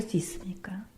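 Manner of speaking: 속삭이듯 묻기; 나직한 속삭임으로 물어보듯; whispered question. Real, not acted. Preacher asks congregation intimately. (성도들에게 속삭이며 묻는)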